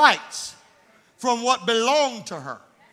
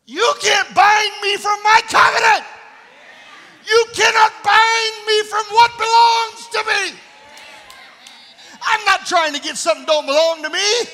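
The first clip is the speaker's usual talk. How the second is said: loud, high, fervent preaching shout; 10 semitones higher, 10 dB louder than usual. Real, not acted. Preacher defying the devil, shouting. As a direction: defy the devil at full force.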